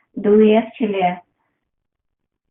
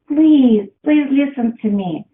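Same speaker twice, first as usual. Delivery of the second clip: louder, higher plea that eases off; pleading outcry; 4 semitones higher, 5 dB louder than usual. Acted. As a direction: cry out as the body begging to be heard. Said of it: "cry. Body's like".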